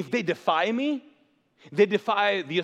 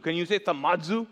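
ordinary talk

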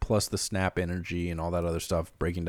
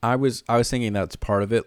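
normal talking voice

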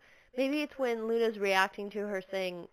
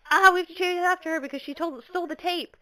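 urgent; 9 semitones higher, 6 dB louder than usual. Retold or imitated. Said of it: "he's like".

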